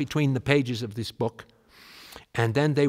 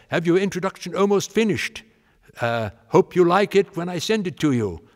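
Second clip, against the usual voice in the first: higher, louder posh Englishman; English gentleman voice; 7 semitones higher, 6 dB louder than usual. Retold or imitated. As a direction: read the card in a hint of Elgar's voice. Elgar's card read aloud.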